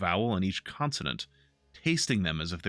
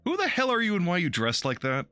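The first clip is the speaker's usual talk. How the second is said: incredulous; 10 semitones higher, 4 dB louder than usual. Real, not acted. Player challenges the stranger.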